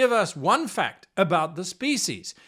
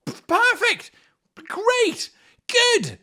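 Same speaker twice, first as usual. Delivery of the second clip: very high bursts of praise; gushing praise; 14 semitones higher, 4 dB louder, slower than usual. Pretend. Game master praising a silly player answer, high gushing. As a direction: cheer each word high and bright.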